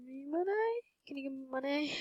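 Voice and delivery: small, high pleading voice; playful begging. Pretend. Player asking others to give money, playful high begging.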